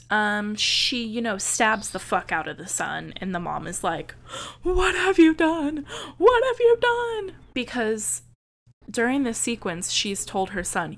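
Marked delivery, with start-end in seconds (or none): 4.6-5.9 higher, louder panicked cry; imitating a mother's shocked cry; mock horrified mother. Mother voiced finding stabbed son. 6.2-7.4 much higher, louder shrieking repeat; hammed-up mother's shriek; mock hysterical mother. Mother voiced, repeated cry.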